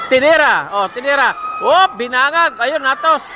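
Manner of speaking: loud, high calls of each hit; excited rally commentary; excited play-by-play. Real, not acted. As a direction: shout out each attack.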